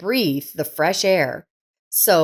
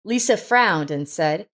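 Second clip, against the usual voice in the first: usual speaking voice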